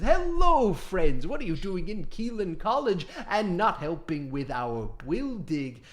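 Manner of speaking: lightly voiced cheerful giant; a hint of a jolly big creature; Loxodon voice. Loxodon NPC greeting party.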